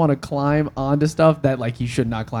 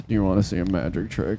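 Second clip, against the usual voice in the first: low, creepy serial killer voice; David Blaine voice; 7 semitones lower, 4 dB quieter than usual. Retold or imitated. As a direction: menace the listener with a low offer. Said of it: "David Blaine sounds like a fucking serial killer".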